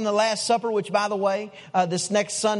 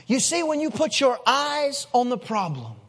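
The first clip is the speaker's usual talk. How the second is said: ordinary delivery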